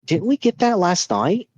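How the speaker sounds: high-pitched incredulous question; mock-baffled, pitched well up; puzzled dad. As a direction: imitate a parent doubting his own memory. Puzzled dad questioning his son.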